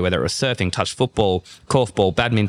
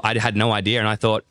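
ordinary talk, nothing marked

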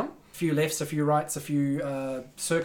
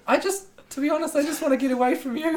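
high voice; 11 semitones higher, 3 dB louder than usual. Acted. Player voicing character to companion.

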